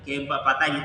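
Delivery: ordinary talk, nothing marked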